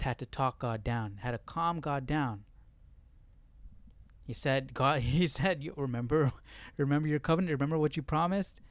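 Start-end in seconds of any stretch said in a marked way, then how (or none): none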